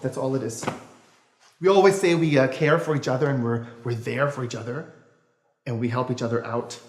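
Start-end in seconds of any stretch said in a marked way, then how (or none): none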